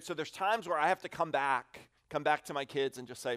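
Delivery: ordinary delivery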